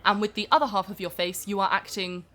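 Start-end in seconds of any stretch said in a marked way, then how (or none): none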